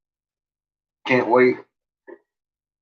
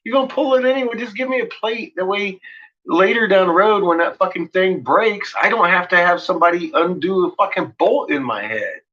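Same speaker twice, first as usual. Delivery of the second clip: excited retort; 7 semitones higher than usual. Retold or imitated. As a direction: fire it back, all worked up. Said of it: "I was like".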